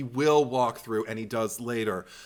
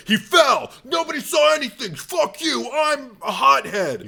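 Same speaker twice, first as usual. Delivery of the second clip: much higher and louder hammed-up shouting; mock thug yelling; 11 semitones higher, 7 dB louder than usual. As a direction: bellow it like a cartoon hothead. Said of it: "and just like".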